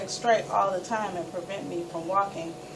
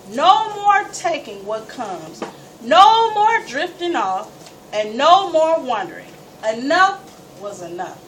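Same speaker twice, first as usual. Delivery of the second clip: slow, louder, fierce resolve; determined, fed-up declaration; defiant vow; 3 semitones higher, 7 dB louder than usual. Retold or imitated. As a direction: hammer each "no more" down like a promise. Vowing to beat her illnesses.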